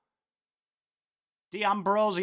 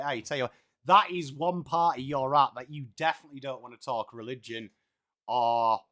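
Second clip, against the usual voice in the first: put-on ocker Aussie accent; 7 semitones lower than usual. Streamer mocks party guests in broad Aussie accent. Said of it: "stuck with this accent forever".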